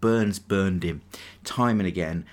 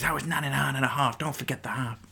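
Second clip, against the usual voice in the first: badly faked American accent, higher pitch; Rivers impression; 5 semitones higher, 3 dB quieter, faster than usual. Sports podcaster quoting his quarterback in a bad American accent. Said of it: "Awful accent there".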